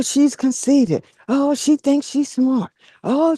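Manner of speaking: usual speaking voice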